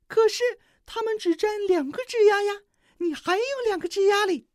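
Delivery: 很高很响的尖细鸟声; 尖着嗓子缠着要; 小黄雀央求. A narrator's reading. (小黄雀尖声央求小鹿)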